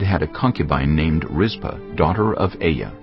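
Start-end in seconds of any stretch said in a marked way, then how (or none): none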